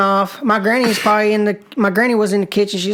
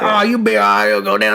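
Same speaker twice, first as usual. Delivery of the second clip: dismissive pa in a put-on voice; imitated pa. Pa tells his worried grandson it's fine, dismissive. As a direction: brush it off like an unbothered old pa. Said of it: "He's like".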